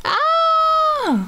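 sudden very high shriek; high scream. Acted. Fish shrieking as the biting shark arrives.

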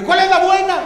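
high, loud baffled voice of a reader; mock confused reader. A reader lost among versions, high, loud and baffled. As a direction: squeal it as a lost reader who can't pick a version. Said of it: "es como de".

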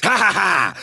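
low, mocking villain laugh; taunting laugh. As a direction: laugh at the opponent's pain. Taunting laugh after hitting.

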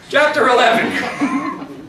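giggly, slow chapter call; laughing. Real, not acted. Reader still giggling as she names the chapter. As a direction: laugh through the title.

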